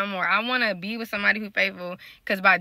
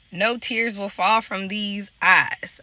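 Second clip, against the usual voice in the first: slow vow; much slower than usual. Real, not acted. Firm vow not to cry.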